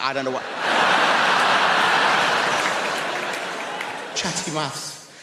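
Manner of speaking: usual speaking voice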